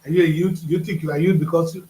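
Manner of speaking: ordinary talk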